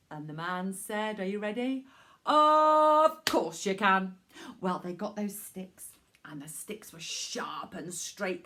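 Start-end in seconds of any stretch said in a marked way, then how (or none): 2.3-4.2 booming kind man. Acted. Man answers kindly. 6.2-8.4 whisper. Acted. Whispered description.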